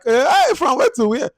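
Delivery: mock shock at a plot turn; incredulous outburst. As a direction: squeal of amazed disbelief. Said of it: "I'm like".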